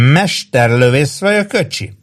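put-on high, slow drunken boast; swooping, teasing tipsy dare; grinning challenge. A narrator's reading. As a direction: brag and goad with a grin.

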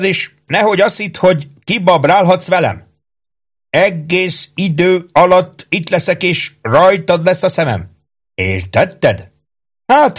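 high, insistent, threatening orders; bossy sergeant Ike, warning. Acted. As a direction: warn the soldier that he is being watched. (fenyegetőző, magas őrmesterhang)

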